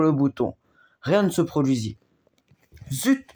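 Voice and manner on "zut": sharp, high-pitched mock fume; a teddy bear losing his temper, lightly; angry outburst. A narrator's reading. Teddy bear, batteries forgotten again, a mild mock fume. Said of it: "tempêta l'ours".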